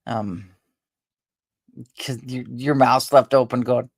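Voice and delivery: ordinary delivery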